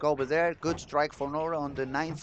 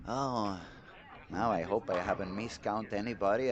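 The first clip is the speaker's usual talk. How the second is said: ordinary talk, nothing marked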